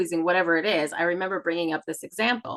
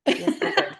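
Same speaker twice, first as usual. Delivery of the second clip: cheeky admission; 9 semitones higher than usual. Retold or imitated. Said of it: "And I was like".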